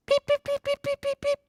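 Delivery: tiny very high peeping; chick peeps. A narrator's reading. Baby chicks peep at Biscuit, tiny high cheeps. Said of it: "That's the sound the chicks make".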